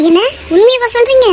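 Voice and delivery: high, astonished question; amazed disbelief. Acted. Friend hears the missing girl has phoned, amazed. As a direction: ask it high, hardly believing the news.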